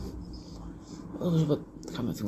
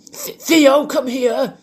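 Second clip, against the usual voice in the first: loud, high call to a dog; shouting in a park; 5 dB louder, much slower than usual. Shown as a demonstration. Imagined owner calling Theo back, shouting. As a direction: shout the pet back, voice pitched way up. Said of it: "I wouldn't be in a park going".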